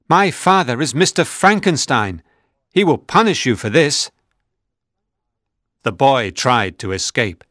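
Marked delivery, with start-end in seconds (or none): 0.1-4.1 put-on high, raised child's voice of threat; a scared boy threatening up high; defiant boy. A narrator's reading. A boy warning the monster that his father will punish him, high and raised. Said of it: "screamed the boy".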